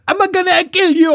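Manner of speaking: high loud frantic screech; pinned kid's shriek. Retold or imitated. Pinned boy with knife screams at the kids, high frantic screech. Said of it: "he's like".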